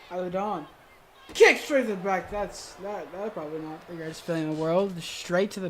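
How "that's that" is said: ordinary delivery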